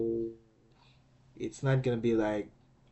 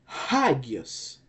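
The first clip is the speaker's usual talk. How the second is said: hagios with the first syllable raised; stress on first syllable. Shown as a demonstration. Teacher showing the accented alpha, hagios stressed on its first syllable. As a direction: hit the opening of hagios, then fall away. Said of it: "because it bears the acute accent again".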